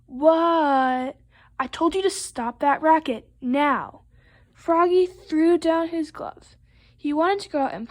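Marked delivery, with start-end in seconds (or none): 0.0-1.2 loud, drawn-out yell back; long, stretched storybook shout; shouted reply. Acted. Shouting back to yelling Dad. 1.6-4.0 raised-voice scolding order; storybook parental shout; yelling dad. Acted. Dad yells the order again.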